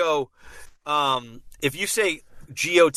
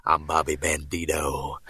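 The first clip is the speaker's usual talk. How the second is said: very low, slow drawn-out impression; low slow movie-star impression; Bobby Bandito; 11 semitones lower than usual. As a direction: draw the name out low and lazy. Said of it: "he posted Bobby Bandito, his, like".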